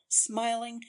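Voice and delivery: usual speaking voice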